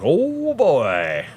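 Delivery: put-on high, stretched "Oh" then "boy"; mock alarm. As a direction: act startled by the scary teeth. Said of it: "you'd be like".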